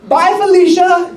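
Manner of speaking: high, bright child's voice imitated; little girl's voice. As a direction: play the six-year-old saying it with a laugh. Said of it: "my precious little girl, she's like".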